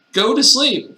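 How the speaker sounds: loud, high, comic command; insistent order. Acted. Player passing on Samuel Jackson's advice, insistent.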